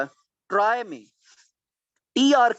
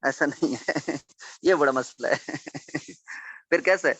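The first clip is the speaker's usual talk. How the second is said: real chuckle through the words, lower pitch; amused laughing; 6 semitones lower than usual. Real, not acted. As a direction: echo the answer and break into laughter. Teacher laughs at student's contradicting answer. (हँसते हुए, मज़े लेकर)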